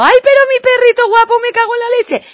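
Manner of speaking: high, loud gushing greeting; a family member bursting in to fuss over the dog; cheerful shouting. Retold or imitated. Family member greets the dog at the door, gushing high shout. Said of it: "Grita de buen rollo".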